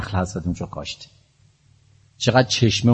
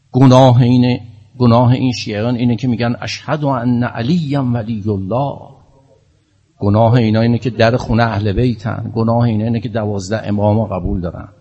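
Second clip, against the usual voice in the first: loud, rising impassioned lament; preaching at full force; 2 semitones higher, 7 dB louder than usual. Real, not acted. Preacher pleading to the Imam for persecuted Shia. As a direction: build to a crying peak of fervor.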